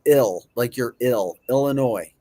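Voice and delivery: ordinary delivery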